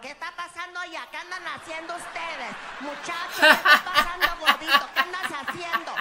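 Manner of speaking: a panicked maid yelling through the hall; frantic housekeeper. Retold or imitated. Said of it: "Housekeeping is freaking out".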